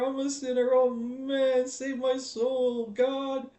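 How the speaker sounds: hammed-up confession of weakness to God; mock lovestruck sinner. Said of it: "You're like".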